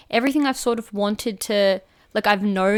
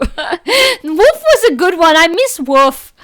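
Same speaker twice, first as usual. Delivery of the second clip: laughing; 6 semitones higher, 8 dB louder, slower than usual. Real, not acted. Nostalgic about old catchphrase.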